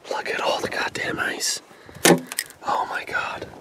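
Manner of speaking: muttered whisper at the ice. Real, not acted. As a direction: whisper in dismay. Dismayed at frozen straps.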